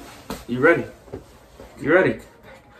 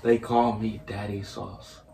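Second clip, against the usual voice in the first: slow, soft and low, each word clear; slower repeat; 4 semitones lower, 10 dB quieter, slower than usual. Real, not acted. Player repeats his gamer name for a guessing friend, slower. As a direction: repeat it slowly and quietly. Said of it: "Say it again, slower".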